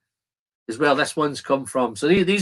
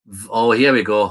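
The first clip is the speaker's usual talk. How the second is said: ordinary talk, nothing marked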